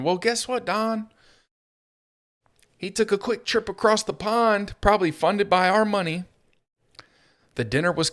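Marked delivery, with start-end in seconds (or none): 0.0-6.2 mock taunting. Sarcastic taunt at Trump.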